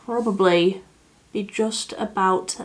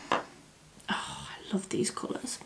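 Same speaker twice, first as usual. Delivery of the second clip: soft breathy whisper; 6 semitones lower, 15 dB quieter, slower than usual. Real, not acted. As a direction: whisper it in awe. Quietly admiring the colours.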